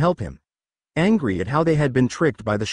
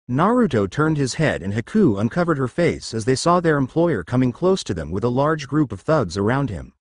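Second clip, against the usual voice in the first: ordinary delivery, nothing marked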